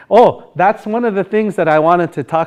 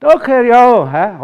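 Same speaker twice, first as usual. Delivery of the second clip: "Doked yaun he" slow, high and loud in a birdsong tune; drawn-out meadowlark tune on "Doked yaun he"; old Dakota sing-song; 7 semitones higher, 6 dB louder, much slower than usual. Shown as a demonstration. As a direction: lilt "Doked yaun he" high, then let it fall. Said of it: "now you sound like an old Dakota".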